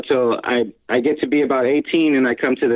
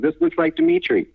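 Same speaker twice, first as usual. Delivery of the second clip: put-on girl's voice. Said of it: "found the I D ... and goes".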